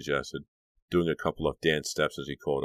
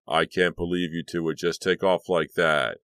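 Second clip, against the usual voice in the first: scolding; 7 dB louder than usual. Acted. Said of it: "Billy scolding Kit and Dee".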